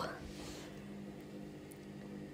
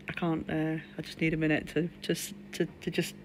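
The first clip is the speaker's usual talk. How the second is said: fading, shaken; 6 semitones lower, 12 dB quieter than usual. Real, not acted. Shaken streamer on the floor tells viewers she needs a minute, fading to near silence. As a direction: voice drains much softer, words trailing off.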